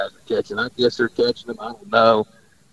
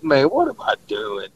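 ordinary talk

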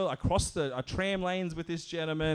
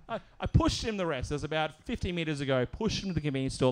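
usual speaking voice

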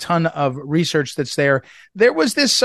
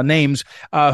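ordinary talk, nothing marked

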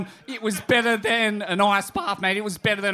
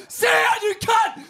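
high shouted order, a touch hammed up; retold yell at dog; 11 semitones higher, slower than usual. Man retelling how he yelled at his dog, high mock shout. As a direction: bark it at the runaway dog, mock scream. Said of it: "I'm screaming at my dog".

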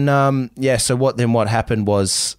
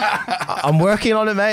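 laughing; 10 semitones higher, slower than usual. Real, not acted. Teased about winning her back.

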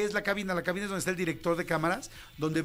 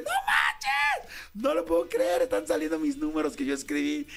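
excited shout. Retold or imitated. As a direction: yelp with thrilled surprise. Sees his numbers on air.

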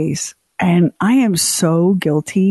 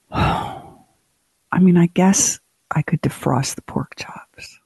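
whispered, bored inner thought; mock whisper; 3 dB quieter than usual. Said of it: "I'm over there going".

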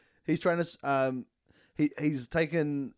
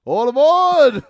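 loud high boarding call; 12 semitones higher, 14 dB louder, much slower than usual. Acted. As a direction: shout it like a conductor. Calling out as ship crew.

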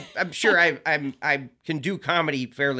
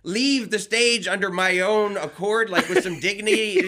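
high, louder exasperated outburst with laughter breaking in; comic snapping at the usher shining a flashlight; 6 semitones higher, 5 dB louder than usual. Retold or imitated. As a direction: lose it at the usher, half laughing. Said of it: "I finally snapped at him".

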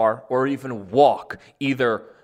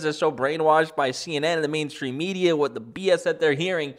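usual speaking voice

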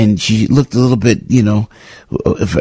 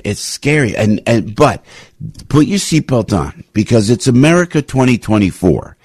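usual speaking voice

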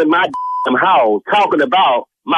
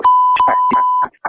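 a high burst of laughter as the prank sinks in; sudden relieved laugh. Real, not acted. Husband learning the church call was a prank, high relieved laugh.

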